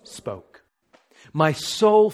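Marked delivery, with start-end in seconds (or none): 0.0-0.6 "Spoke." dropped low and soft, almost breathed; hushed spoke. Shown as a demonstration. Preacher reading the verse to the congregation, falling to a hush. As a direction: let "Spoke." fall away to a near hush. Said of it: "listen to this carefully".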